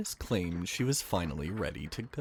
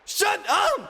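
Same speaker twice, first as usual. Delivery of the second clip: shrill, very high and loud shout; furious screamed command; 11 dB louder than usual. Acted. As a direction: scream him silent. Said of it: "the mechanist shouted".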